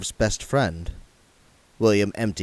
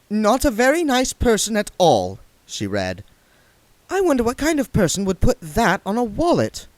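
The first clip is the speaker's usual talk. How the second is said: put-on higher girl's voice; young woman voice; 12 semitones higher than usual. A narrator's reading. Saccharissa tells William about the wallet, higher.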